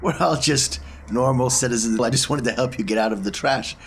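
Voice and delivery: usual speaking voice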